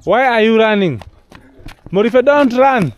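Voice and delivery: loud, high call to a running dog. Real, not acted. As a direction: shout after the dog to stop it.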